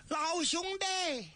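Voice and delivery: high, slow drawn-out shout; shouting a name. Acted. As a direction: call his name out across the field. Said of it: "这赵壁就喊". (高声拖长呼喊)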